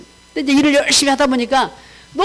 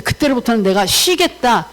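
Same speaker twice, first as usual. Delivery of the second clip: ordinary delivery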